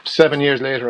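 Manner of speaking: usual speaking voice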